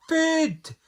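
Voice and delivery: high, loud burst of a shout. A narrator's reading. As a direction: shout it out as a hungry cry. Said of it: "shouted the dwarfs".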